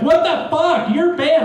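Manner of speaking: high, loud mock outrage; outraged streamer. Streamer banning a rude commenter, mock outrage. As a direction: yell it, high and indignant. Acted angry TikTok streamer's reaction.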